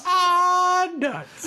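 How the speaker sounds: high, loud mock yelp; imitación de viejo buscador; old prospector. Echoing the yelp.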